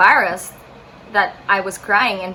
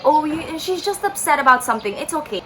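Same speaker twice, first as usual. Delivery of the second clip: higher, light imitation of an aunt; reassuring; 7 semitones higher than usual. Aunt not wanting to waste the man's time, reassuring. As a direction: brush it off lightly in a raised pitch.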